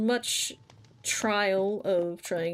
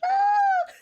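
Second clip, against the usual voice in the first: high loud panicked scream; terrified scream; 7 dB louder than usual. Retold or imitated. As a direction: let out a shrill scream of fright. Warden attacks in the dark.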